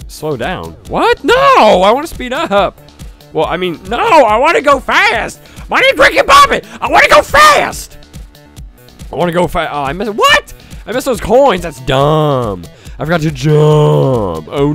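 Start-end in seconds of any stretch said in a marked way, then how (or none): none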